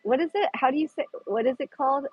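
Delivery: ordinary delivery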